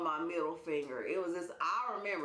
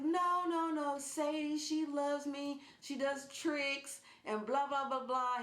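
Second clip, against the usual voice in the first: high, drawn-out doting plea; cooing protest, retold; 7 semitones higher, slower than usual. Owner defending her cat to John, high slow cooing. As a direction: stretch it out in a high mock-sweet voice.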